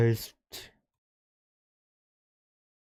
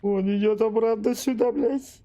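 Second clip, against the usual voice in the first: panicked; 10 semitones higher, 4 dB louder than usual. Real, not acted. Monster returning, streamer panics.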